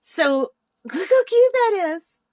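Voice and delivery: high, slow delighted gush; excited. Real, not acted. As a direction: swoon over how cute it is. Gushing over painting again.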